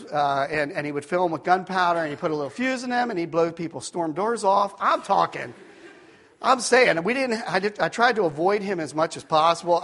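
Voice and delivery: ordinary talk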